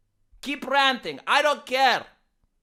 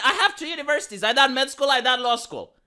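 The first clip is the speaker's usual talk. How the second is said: usual speaking voice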